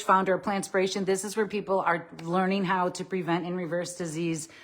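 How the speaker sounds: ordinary delivery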